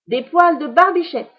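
put-on high, loud, teasing boy; mischievous. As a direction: tease her gleefully. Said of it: "poursuivit Pierre malicieusement".

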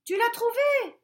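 very high, loud call up. A narrator's reading. Rabbit calling up the tree to the bear, very high and loud. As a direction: shout up the tree. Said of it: "cria un des lapins".

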